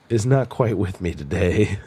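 normal talking voice

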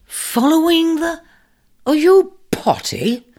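can't believe his ears; incredulous. Acted. Taurus incredulous at the ram.

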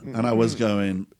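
ordinary talk, nothing marked

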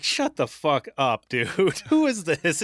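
retold shock at a celebrity calling; stunned disbelief. Telling the celebrity caller off, stunned and loud.

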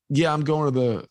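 ordinary talk, nothing marked

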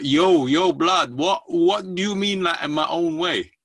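put-on loud, high street kid voice; street slang voice. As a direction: shout it like a cocky street kid. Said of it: "He goes like".

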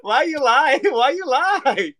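laughing mock accusation.

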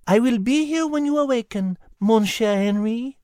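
high, gentle French cat voice; soft cat voice. A narrator's reading. Cat promising to stay beside him, soft. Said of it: "Liz answered softly".

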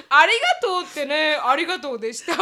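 loud, laughing thanks; laughing thank-you. Retold or imitated. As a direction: blurt it out clumsily. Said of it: "みたいな".

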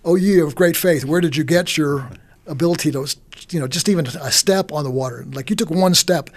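normal talking voice

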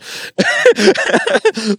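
loud high laugh; high burst of laughter. Real, not acted. Laughing at own story.

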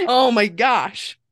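slow, drawn-out oh my gosh through laughter; laughing. Real, not acted. Laughing at her own joke backfiring, slowly. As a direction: let the laugh spill into the words.

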